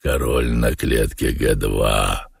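very low, slow character voice; Воланд. A narrator's reading. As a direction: state it low and unhurried. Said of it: "сказал Воланд". (очень низко и медленно)

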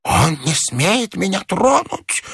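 put-on high, drawn-out old man's voice; fretful high protest, lost in his own fear; slow, high-pitched old man's wail; agitated old man. A narrator's reading. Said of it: "продолжал старик".

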